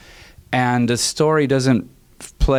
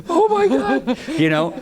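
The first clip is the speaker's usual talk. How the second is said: laughing disbelief. Real, not acted. Composer recalling the two-day rewrite, laughing. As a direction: laugh at how absurd it was.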